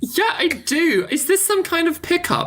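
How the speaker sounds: put-on very high, whiny girl voice; crying girl. Crying girl doubting a pickup from adventurers, upset and squeaky. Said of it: "the crying girl".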